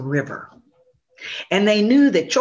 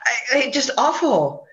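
mocking laugh; 7 semitones higher than usual. Possum mocking rabbit's tail.

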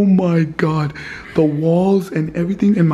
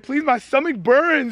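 higher, slow plea in pain; pained pleading; 6 semitones higher, slower than usual. Real, not acted. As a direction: beg through the sting of a burn. Pleading after her, stomach burned.

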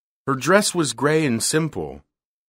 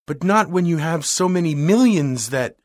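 despairing; 6 semitones higher, 3 dB louder than usual. A narrator's reading. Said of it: "gesture of despair".

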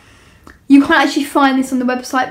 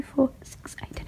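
whispered counting; 3 semitones higher, 10 dB quieter, slower than usual. Real, not acted. Counting shoes under her breath.

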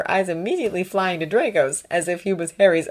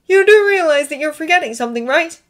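higher, louder, impatient reminder; testy, raised-pitch prompt; 9 semitones higher, 5 dB louder than usual. A narrator's reading. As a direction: point it out with impatience. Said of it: "impatiently pointing out".

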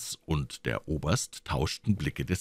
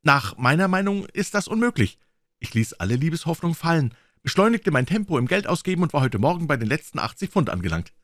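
put-on higher-pitched, lively young man telling his own folly; junger Mann, bitter-närrisch; 9 semitones higher, 3 dB louder than usual. (hoher, lebhafter junger Mann)

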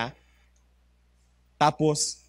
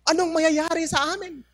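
faked cry for help; 10 semitones higher than usual. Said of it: "Sumigaw din siya".

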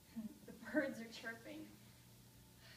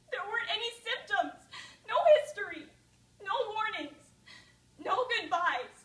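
high, loud, grief-stricken protest; breaking down at her father's grave, crying out; anguished outburst; 8 semitones higher, 14 dB louder than usual. Acted. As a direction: rail at the loss.

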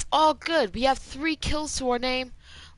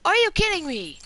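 exasperated; 7 semitones higher, 3 dB louder than usual. Real, not acted. Outraged after death.

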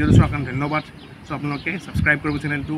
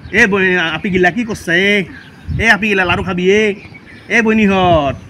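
louder, higher Nalbari Assamese demo; Nalbari accent; 4 semitones higher, 6 dB louder than usual. Shown as a demonstration. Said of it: "This is Nalbari accent".

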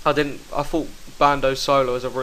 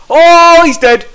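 excited shout; 15 dB louder, much slower than usual. Real, not acted. Opponent falls in fight.

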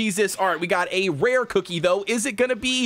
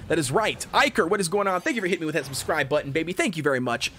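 normal talking voice

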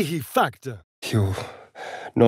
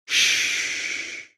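usual speaking voice

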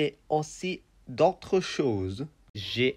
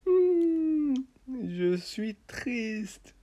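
sad little flower; 10 semitones higher, 4 dB quieter, slower than usual. Acted. Sad flower puppet speaks.

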